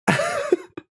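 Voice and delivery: short high laugh. Real, not acted. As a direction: burst out laughing.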